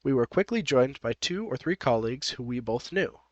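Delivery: plain reading voice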